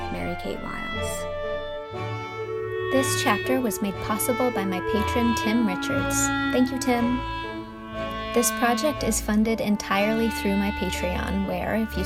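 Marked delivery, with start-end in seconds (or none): none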